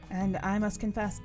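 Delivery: usual speaking voice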